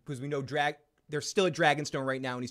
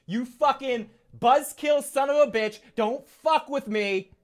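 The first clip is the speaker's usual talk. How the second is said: high, loud hammed-up snarl; mock angry Night King; 11 semitones higher, 6 dB louder than usual. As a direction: bark it at Bran, slowing at the end. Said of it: "looks at Bran and goes".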